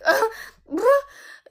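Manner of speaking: loud high burst of laughter; incredulous laugh. Real, not acted. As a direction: laugh out at how weird he is. Incredulous laugh about him.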